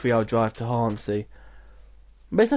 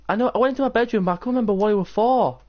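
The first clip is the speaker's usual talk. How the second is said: much higher protesting whine; high exasperated protest; defensive whine; 9 semitones higher, faster than usual. Retold or imitated. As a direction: whine back at mum. Defensive reply to Mum.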